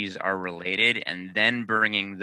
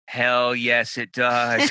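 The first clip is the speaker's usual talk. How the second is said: louder, higher, emphatic quoted agreement; emphatic confirmation; 3 semitones higher, 5 dB louder, much slower than usual. Retold or imitated. Friend's girlfriend confirming the whiny tears, loud and emphatic. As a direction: belt it out as a wholehearted yes. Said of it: "she was just like".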